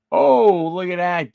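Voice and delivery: loud, high oh at a nice card; impressed oh. Real, not acted. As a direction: react to a great pull.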